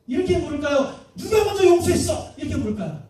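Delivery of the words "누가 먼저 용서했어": lightly acted judge, raised higher voice; mildly demanding acted question; Jesus questioning. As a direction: put the question out higher, a little loud. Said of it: "예수님이".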